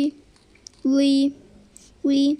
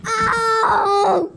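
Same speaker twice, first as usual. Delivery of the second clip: faking pain; 6 semitones higher than usual. Pretend. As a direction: yelp like you just got hit. Character run over in game.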